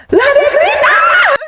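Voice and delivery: laughing shout. Real, not acted. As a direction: burst out laughing as you yell it.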